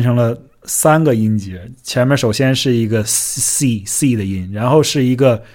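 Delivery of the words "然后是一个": ordinary talk, nothing marked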